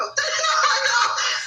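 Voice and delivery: a sudden high laugh through tears; tearful laugh. Real, not acted. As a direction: laugh out while choking up. Host moved to tears.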